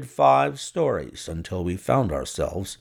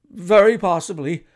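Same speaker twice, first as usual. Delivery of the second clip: ghastly forced smile; 6 semitones higher, 5 dB louder than usual. A narrator's reading. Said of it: "with a ghastly smile".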